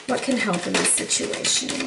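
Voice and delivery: soft, low murmur to the cards; hushed question. Real, not acted. Reader softly asks the cards for help, hushed. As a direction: ask it under your breath.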